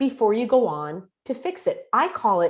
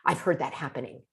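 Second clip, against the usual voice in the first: softer, quick sympathetic murmur; soft validating; 6 semitones lower, 6 dB quieter, faster than usual. Shown as a demonstration. Coach validating a friend's loss, softly. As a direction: lower and softer, gently enlarging it. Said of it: "make it even bigger".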